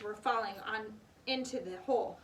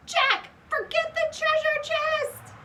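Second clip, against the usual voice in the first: loud, much higher, slow plea; urgent cry; 11 dB louder, slower than usual. A narrator's reading. Annie begs Jack to leave the chest, loud slow cry. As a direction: plead urgently. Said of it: "cried Annie".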